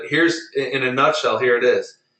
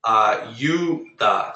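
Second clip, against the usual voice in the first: Ayuda with stress on YU, a-YU-da; second-to-last stress. Shown as a demonstration. Teacher modelling Ayuda for the class, stressed on YU. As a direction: lean on the middle YU of Ayuda. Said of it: "Second to last syllable is the U".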